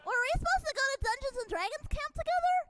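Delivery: put-on high, fast nerdy kid voice; a jittery kid at camp; over-allergic kid. Shown as a demonstration. Showing friends his allergy kid voice, nervous and fast. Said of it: "I like to do my over-allergic kid".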